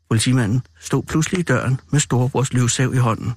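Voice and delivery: ordinary delivery, nothing marked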